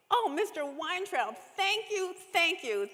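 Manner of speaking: high, loud burst of thanks; delighted. A narrator's reading. Rachel thanking the grocer, high and delighted. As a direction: squeal out your thanks, overjoyed. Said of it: "suddenly screamed with delight".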